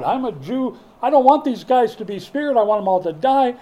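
loud, high, spiteful demand; proud spiteful Jonah. Acted. Jonah refusing mercy for the Ninevites, spiteful. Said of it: "he was still so proud".

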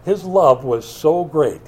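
ordinary delivery

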